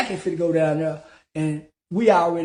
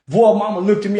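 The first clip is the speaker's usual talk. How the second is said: ordinary delivery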